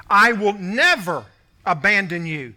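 "never" stretched and hit firmly; stress on never. Shown as a demonstration. Preacher quoting God's promise to the congregation, "never" firmly stressed. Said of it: "underline the word never".

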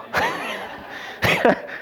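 breath of laughter through emotion; emotional laugh. Real, not acted. Preacher overcome telling a father-son moment, choked-up laugh. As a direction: laugh while choking up. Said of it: "I'm kind of emotional right now".

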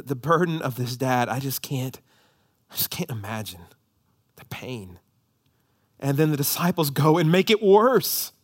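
ordinary delivery

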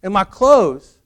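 high, loud voice of a marvelling husband; hammed-up wonder at a household miracle; mock amazement. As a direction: act astounded, building in pitch and volume. Husband marvels at magic basket.

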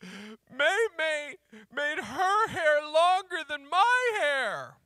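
put-on high, loud, slow tearful child; young daughter sobbing out her complaint to her dad; crying little girl. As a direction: wail it out like an upset six-year-old. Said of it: "And she said".

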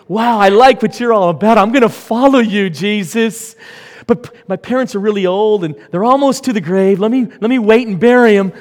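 fast, loud and high, gushing excuses; eager disciple. Acted. Disciple excitedly telling Jesus he must wait to bury his parents. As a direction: rattle it off like an eager follower.

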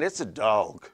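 "dog" low, with an AW vowel; regional "dawg". Shown as a demonstration. Preacher hinting at his home speech, "dog" said "dawg". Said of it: "D A W G S is how that is pronounced".

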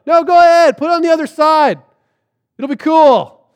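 high, loud, breezy urging; calling out. Retold or imitated. Stranger on shore urges doubting fishermen, calling out. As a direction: coax them from a distance.

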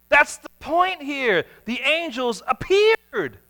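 ordinary delivery

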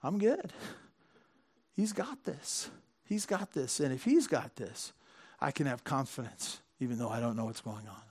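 soft, hushed trust in the Father; calm reassurance. Retold or imitated. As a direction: quietly reassure yourself like a calmed child. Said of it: "and we go".

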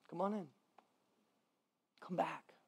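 soft, hushed come on in; tender invitation. Acted. Father welcoming the returning son, soft and gentle. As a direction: gently coax the son back inside. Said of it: "The father goes".

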